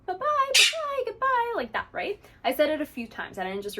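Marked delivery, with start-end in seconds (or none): 0.1-1.5 high, louder sing-song goodbye; cheerful high goodbye for the parrot; happy little tone. Shown as a demonstration. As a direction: sing it out happily to the bird. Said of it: "a happy little tone to it".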